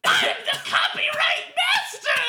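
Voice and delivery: very high, slow falsetto boast; Mickey Mouse. Pretend. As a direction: proclaim it as a squeaky cartoon final boss. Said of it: "Mickey Mouse Kubo".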